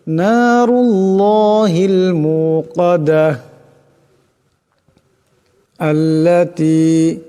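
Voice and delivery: slow melodic tajweed chant, drawn-out vowels; measured, melodic Quranic recitation. Shown as a demonstration. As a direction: recite it slowly as a tajweed lesson. Teacher recites the verses again.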